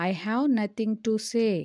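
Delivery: said slowly as one phrase; teacher saying the whole sentence slowly; slow model sentence. Shown as a demonstration. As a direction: read "I have nothing to say." slowly in one go. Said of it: "దీన్ని ఇంగ్లీష్లో ఏమనాలి?".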